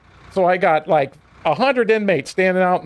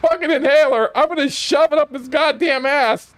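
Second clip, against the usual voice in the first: higher, raised angry yell, retold; 7 semitones higher than usual. Officer yelling about the inmate's inhaler, angry and raised. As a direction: fume it out at full steam. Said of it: "now I'm yelling".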